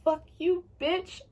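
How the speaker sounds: high, mock-defiant kiss-off; sassy actors telling her off. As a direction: fling it at her like a diva walking out. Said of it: "everybody in the show was like".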